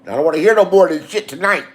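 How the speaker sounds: usual speaking voice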